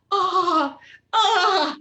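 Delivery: high, loud mock orgasm moans; imitating faked moans. Woman faking it in the restaurant, mock moaning. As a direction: ham up the fake moan from the restaurant scene. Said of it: "she was like".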